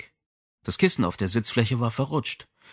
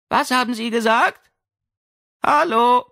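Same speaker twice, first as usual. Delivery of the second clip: put-on high girlish voice; 13 semitones higher, 6 dB louder than usual. Berger behind door.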